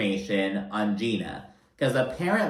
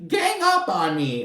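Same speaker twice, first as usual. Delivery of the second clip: higher, trailing sulky echo; toned-down mocking repeat; milder mock whine; 7 semitones higher than usual. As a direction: repeat it as a fading pouty whine. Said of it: "And Tamara's like".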